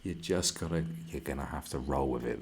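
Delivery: ordinary delivery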